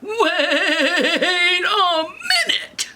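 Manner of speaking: high, loud, long wobbling cry; hugely drawn-out comic alarm; outraged Papa Bear. Acted. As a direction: stretch it into an indignant bellow. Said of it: "then Papa Bear goes".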